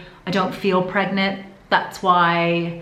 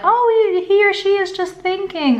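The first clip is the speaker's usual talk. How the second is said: mock sweet voice; 12 semitones higher, 4 dB louder than usual. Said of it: "Some would say".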